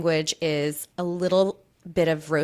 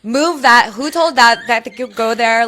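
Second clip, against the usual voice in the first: bossy planner; 3 semitones higher, 9 dB louder, slower than usual. Acted. Bossing people at a wedding.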